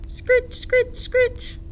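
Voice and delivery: high squeaky scritch noises; leaf scratching sound. A narrator's reading. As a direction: voice the rake scratching lightly. Rustling sound effect in story.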